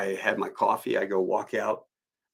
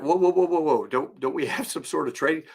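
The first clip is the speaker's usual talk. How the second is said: alarmed protest; 6 semitones higher than usual. Retold or imitated. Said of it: "I'm like".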